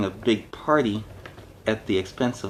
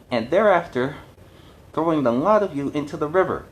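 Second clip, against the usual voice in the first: raised, loud demanding cry; crying out angrily; 4 semitones higher, 4 dB louder than usual. Acted. Fishwife leader threatens the council, loud and angry. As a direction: threaten the council with tar and feathers.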